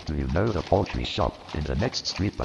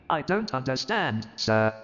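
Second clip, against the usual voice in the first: much higher, stammering disbelief; stunned clerk; 10 semitones higher than usual. Acted. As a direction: voice Bob gaping at the news. Bob confused.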